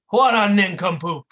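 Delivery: usual speaking voice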